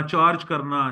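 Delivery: ordinary delivery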